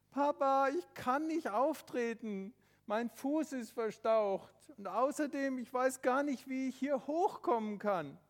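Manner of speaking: put-on a hurt boy explaining to his dad; boy Tobi. Tobi telling his father he is stuck, worried. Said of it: "boy Tobi talks to father".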